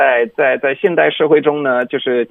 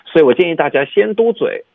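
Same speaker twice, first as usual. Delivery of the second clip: ordinary talk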